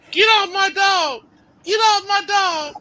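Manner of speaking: high, slow, yelling owner; frantic shout. Retold or imitated. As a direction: shriek it at the man wrestling the dog.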